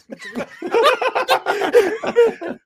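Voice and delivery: shrieking laugh; cracking up high; helpless squealing laugh; high wheezing laugh. Real, not acted. Cracking up after the joke.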